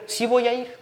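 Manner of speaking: voz de Jesús apenas marcada, algo más aguda; un toque de Jesús sereno. Acted. Jesús responde en el relato. (Jesús responde a Jairo con voz más aguda)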